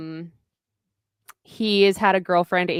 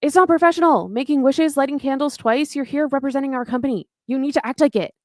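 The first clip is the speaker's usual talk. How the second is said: stern scolding; 7 semitones higher, faster than usual. Retold or imitated. Mock scolding reprimand. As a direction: tell off a coworker for wasting time on wishes. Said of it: "And she's like".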